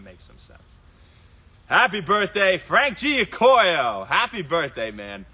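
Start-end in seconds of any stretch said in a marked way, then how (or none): 1.7-4.9 high, drawn-out celebratory holler; loud birthday shout-out. Real, not acted. Host wishing Frank happy birthday, loud celebratory shout. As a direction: holler the birthday wish, stretching out the name.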